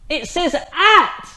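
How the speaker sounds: slow, loud, thrilled announcement; excited discovery. Real, not acted. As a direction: stretch at out with delight.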